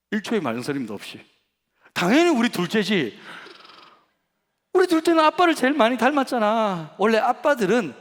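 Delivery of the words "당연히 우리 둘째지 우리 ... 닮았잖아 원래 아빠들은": higher, louder eager father; eager reassurance. Retold or imitated. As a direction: sell it with gusto. Father reassures second daughter. (높고 크게 신나서 달래는 아빠)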